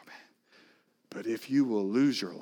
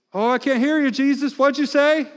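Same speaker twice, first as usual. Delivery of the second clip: much higher, louder sing-song; playing deaf to Jesus, hammed-up; a hammed-up self who won't listen; mock distracted self; 12 semitones higher, 6 dB louder than usual. Mocking self ignoring Jesus.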